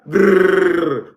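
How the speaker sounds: rolled grrr like a gun firing; mock gunfire noise. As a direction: imitate the gun spraying bullets. Imitating gunmen attacking people.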